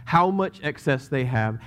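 usual speaking voice

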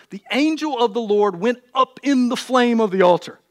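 projected, measured reading with weighted key words; scripture reading. Acted. Preacher reads Samson's birth story, scripture reading. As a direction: proclaim the Bible story to the room.

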